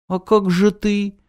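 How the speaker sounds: high little heroine voice; lightly voiced high exclamation; exclaiming. A narrator's reading. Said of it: "воскликнула Наночка".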